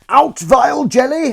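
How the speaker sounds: high, loud, slow stage declamation; Shakespearean King Lear. Acted. Showing casting directors some Shakespeare, loud theatrical declamation. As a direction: declaim it like hammy tragedy. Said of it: "That's King Lear for you".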